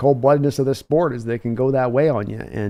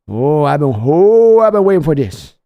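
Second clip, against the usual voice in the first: excited; 7 dB louder than usual. Real, not acted. Hyped after his impression.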